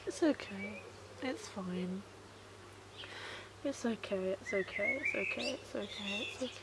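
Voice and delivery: soft repeated murmur, fading away; calm yourself down under your breath; quietly telling herself it's okay; self-soothing. Real, not acted. Soothing herself over dying cat.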